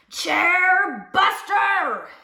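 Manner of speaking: long, loud, drawn-out roar; roaring bear. A narrator's reading. Riled grizzly accuses the chair breaker, roaring. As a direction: stretch and roar each word. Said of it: "he roared".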